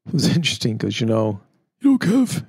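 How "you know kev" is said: high-pitched impression of a friend; imitating his talk. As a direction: put on his higher voice. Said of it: "he talks like that".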